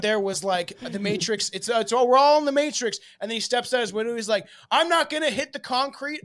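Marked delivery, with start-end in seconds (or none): 0.9-3.0 excited believer. Retold or imitated. Voicing a Matrix believer. 4.7-6.2 cocky believer. Retold or imitated. Voicing the guy stepping out.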